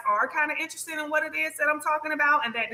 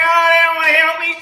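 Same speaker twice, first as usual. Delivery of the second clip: imitating a crying complaint, louder and higher; mock crying whine; 4 semitones higher, 9 dB louder than usual. Mocking clients leaving readings crying, hammed-up whine. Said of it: "come out there crying like".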